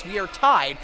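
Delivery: ordinary delivery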